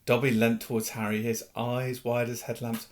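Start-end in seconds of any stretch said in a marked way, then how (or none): none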